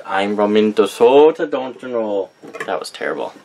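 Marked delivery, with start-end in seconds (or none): 0.0-2.3 hammed-up, badly faked Minnesota accent with big round O's; butchered Minnesota accent. Vlogger joking after saying a candle name oddly, badly faked Minnesota accent. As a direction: do a terrible mock Minnesota accent. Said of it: "I totally just butchered that accent".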